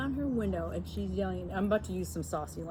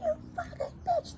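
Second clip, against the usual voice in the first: very high crying screech; mock screaming woman. Mocking the driver's scream, very high. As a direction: shriek it like the angry driver. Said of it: "She's like".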